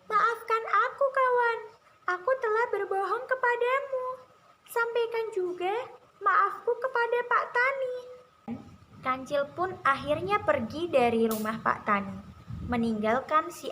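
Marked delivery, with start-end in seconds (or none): 0.0-8.0 very high, loud little animal voice; high storybook trickster saying sorry; mouse-deer character. A narrator's reading. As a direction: call out an apology in a high-pitched voice. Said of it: "dan berkata".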